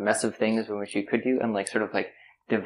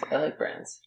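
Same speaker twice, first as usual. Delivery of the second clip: breathy near-whisper, dropping away; 5 semitones higher, 8 dB quieter, slower than usual. Real, not acted. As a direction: say it half under your breath.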